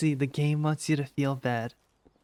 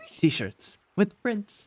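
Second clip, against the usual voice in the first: drawn-out, louder proud reveal; slow reveal; 6 semitones higher, 4 dB louder, much slower than usual. Acted. Entrepreneur unveils the t-shirt idea slowly and proudly. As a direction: stretch it out like a grand unveiling.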